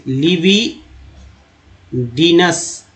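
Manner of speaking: लिबिडिनस very slowly, with a long pause before डिनस; slow pronunciation. Shown as a demonstration. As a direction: split लिबिडिनस into लिबि and डिनस. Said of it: "अच्छे से पकड़िए".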